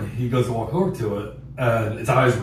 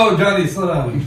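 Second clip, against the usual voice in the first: laughing call out; 8 semitones higher, 7 dB louder than usual. Retold or imitated. Excited call to fast friend.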